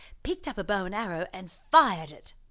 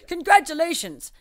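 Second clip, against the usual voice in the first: high, loud proud announcement; loud congratulations; 9 semitones higher, 10 dB louder than usual. Acted. Mayor congratulating the winner on TV, loud and proud. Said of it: "said the mayor".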